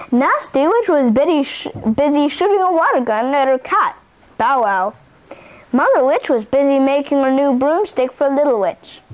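ordinary delivery

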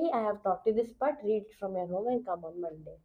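mock teacher. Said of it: "ma'am ने बोल दिया कि".

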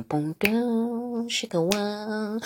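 vocal imitation of background music; mouth-made intro beat. Shown as a demonstration. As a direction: sing the music like a show intro. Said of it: "playing in the background".

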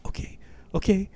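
high, thin, hesitant okay; mock-timid squeak of consent; nervous agreement. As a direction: give in nervously, voice shooting up.

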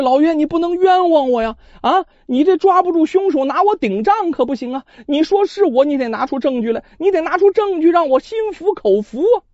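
a cocky suspect arguing back at the magistrate; truculent protest. A narrator's reading. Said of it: "甚是刁蛮".

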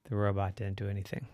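ordinary delivery

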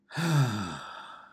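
long falling sigh of exasperation; exasperated sigh. A narrator's reading. Wife on the phone, fed up with her husband: exasperated sigh. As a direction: let out a tired, fed-up sigh. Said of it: "Lisa let out an exasperated sigh".

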